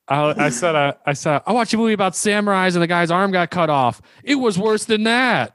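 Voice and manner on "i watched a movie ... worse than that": high, insistent mock-aggrieved voice; overdramatic complaint. Patient comparing his shot to samurai movie, overdramatic complaint. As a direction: exaggerate the suffering, pitched high. Acted over-dramatic answer to nurse.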